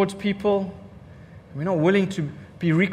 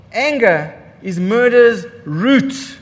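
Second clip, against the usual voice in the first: slow emphatic; 5 dB louder, much slower than usual. Shown as a demonstration. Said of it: "repeated for emphasis".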